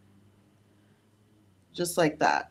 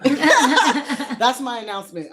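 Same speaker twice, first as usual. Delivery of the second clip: laughing; 8 semitones higher, 4 dB louder, slower than usual. Real, not acted. Giggling after pregnancy reveal.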